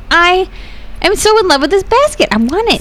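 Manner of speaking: excited. Real, not acted. Admiring basket.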